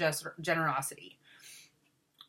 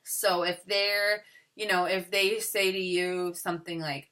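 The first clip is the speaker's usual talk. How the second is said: ordinary talk, nothing marked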